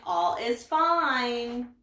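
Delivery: plain reading voice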